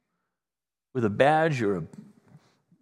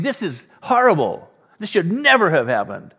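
loud, higher-pitched mock outrage; indignant; 6 semitones higher, 7 dB louder than usual. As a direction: play an outraged fan protesting loudly. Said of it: "they were indignant".

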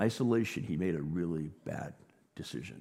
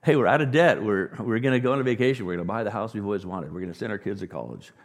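new lottery winner shouting plans; lottery winner excited; 8 dB louder than usual. Retold or imitated. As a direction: burst out like you just won. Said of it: "If somebody wins the lottery".

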